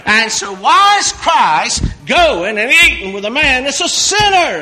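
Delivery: loud, high mock-outraged question; loud indignant preacher; preaching shout. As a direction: thunder the question at the crowd. Preaching peak.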